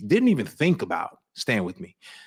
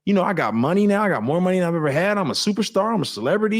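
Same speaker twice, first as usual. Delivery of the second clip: cocky bragging; 7 semitones higher, faster than usual. Retold or imitated. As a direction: puff up like a big-headed star. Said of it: "I'm sitting here like".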